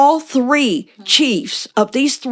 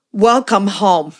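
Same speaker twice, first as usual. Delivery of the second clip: tender welcome; 3 semitones lower, slower than usual. Retold or imitated. Greeting elder with tender welcome.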